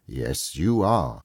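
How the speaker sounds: slow, low, stern reply; mock sternness. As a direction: agree gravely, drawing it out low. Scrooge feigns stern boss to Bob.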